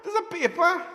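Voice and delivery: put-on laughing as the disappointed kid; laughing child complaint. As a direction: giggle while protesting to mom. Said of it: "I'm like".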